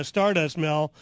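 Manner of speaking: ordinary talk, nothing marked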